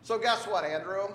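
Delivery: normal talking voice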